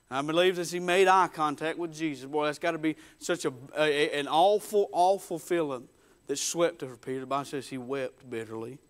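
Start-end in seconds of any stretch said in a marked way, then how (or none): none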